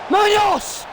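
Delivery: high, loud cry of a name; real startled commentator's shout; alarmed shout. Real, not acted. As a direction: yell the player's name in alarm. Cries out at sudden chance.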